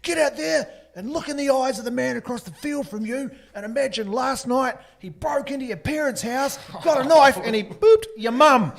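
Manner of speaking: mock army coach roaring the pre-match talk; fired-up coach speech.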